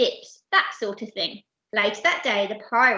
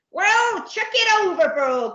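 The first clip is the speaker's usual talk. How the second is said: loud, raised pirate growl; 10 semitones higher, 7 dB louder than usual. Acted. Captain dismissing the baby, loud growled order. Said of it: "growled Captain Am-, Abdul".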